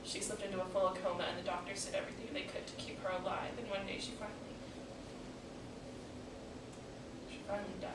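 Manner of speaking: hushed account of a parent's death, heavy pauses; grieving daughter. Acted. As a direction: let it sink quietly. Monologue, recalling mother's death.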